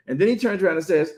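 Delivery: usual speaking voice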